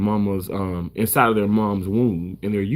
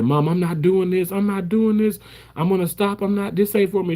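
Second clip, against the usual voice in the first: imitating crying; 8 semitones higher than usual. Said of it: "I started crying".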